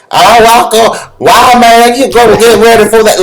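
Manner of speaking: high, loud Southern drawl, slow then rushed; southern drawl coach. Retold or imitated. Coach calling out to Walker in a loud Southern drawl. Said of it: "he's got a southern drawl".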